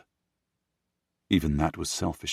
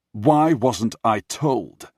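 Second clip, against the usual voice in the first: angry demand; 5 semitones higher, 7 dB louder, much slower than usual. Acted. Master demands answers of butler.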